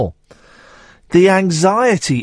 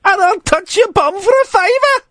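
very high Scottish lassie voice; mock Scottish accent. Host mocking the Scottish caller as a wee lassie, Scottish accent. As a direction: squeak it out in a hammed-up Scottish accent. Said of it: "doing a Scottish accent".

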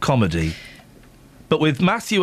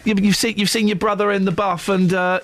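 goading excitement; 7 semitones higher than usual. Pretend. Goading Harry, pitch rising.

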